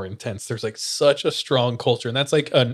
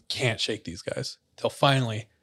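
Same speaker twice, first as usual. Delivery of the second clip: ordinary delivery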